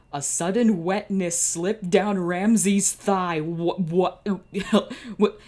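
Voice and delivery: high, loud, slow disbelief breaking into a laugh; shocked outburst. Real, not acted. Reader stunned by a suggestive line, loud disbelieving laugh. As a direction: caught off guard by the line, then cracking up.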